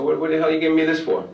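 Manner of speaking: put-on the suspended kid questions the gift, surprised; surprised protest. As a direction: ask in disbelief why you get this. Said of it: "I go".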